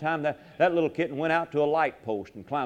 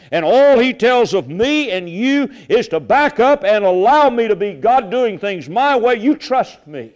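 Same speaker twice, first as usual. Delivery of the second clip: ordinary delivery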